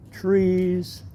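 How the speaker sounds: ordinary delivery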